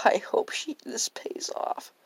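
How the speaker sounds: whispered aside to self; whispering. Acted. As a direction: breathe it, no voice.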